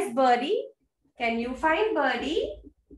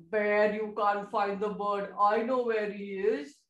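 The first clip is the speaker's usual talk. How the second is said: low, teasing dog voice; low put-on animal voice; storybook doggy; 6 semitones lower, 3 dB quieter than usual. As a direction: play a dog with a secret, pitched down. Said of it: "The doggy came".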